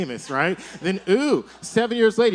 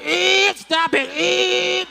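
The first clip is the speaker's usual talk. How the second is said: high, slow, whimpering plea; mock crying; 12 semitones higher, 4 dB louder, much slower than usual. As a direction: beg through fake sobs. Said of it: "like, crying".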